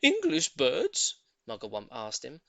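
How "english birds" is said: monkey voice. A narrator's reading. Mugglewump asks.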